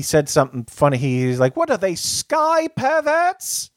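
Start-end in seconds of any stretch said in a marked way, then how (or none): 1.6-3.7 high, slow put-on English accent, mimicking Russell Brand; mock English accent. Podcast host quoting Russell Brand's joke in an English accent. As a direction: drawl it out in a posh-comic English accent. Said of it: "funnier when he says it in his weird English accent".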